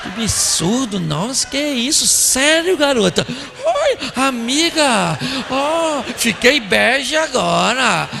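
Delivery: put-on drawn-out, swooping girlfriend exclamations; hammed-up shocked listener, stretching the words; gossiping women's reactions. Woman reacting to her friend's gossip, exaggerated amazement. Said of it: "a outra fica assim".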